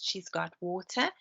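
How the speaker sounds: usual speaking voice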